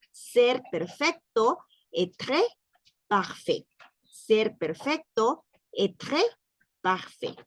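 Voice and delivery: "Ser perfecto" then "être" rising and "parfait" falling, slow and spaced; slow drill. Shown as a demonstration. Teacher drilling the class on "être parfait", slow and spaced. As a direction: model each word slowly for repetition. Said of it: "que en francés sería".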